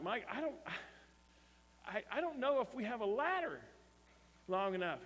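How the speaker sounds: high-pitched, halting retold reluctance; reluctant stammering protest. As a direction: stall and stumble, voice lifted high. Reluctant pastor stalls over the ladder.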